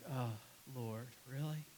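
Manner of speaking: low, very soft tired protest; acted end-of-day weariness; weary reluctance. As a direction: groan it under your breath, worn out. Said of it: "And you're tired".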